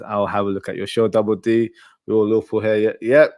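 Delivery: ordinary talk, nothing marked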